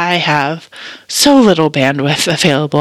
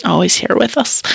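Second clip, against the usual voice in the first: usual speaking voice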